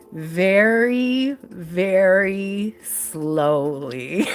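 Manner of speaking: words stretched out, deliberate and drawn out; very slow. Retold or imitated. Monk giving a penance to a teenager, very slowly. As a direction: draw out each word with pauses. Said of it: "say it as slowly as I possibly".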